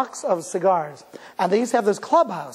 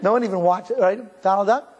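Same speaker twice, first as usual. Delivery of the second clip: ordinary delivery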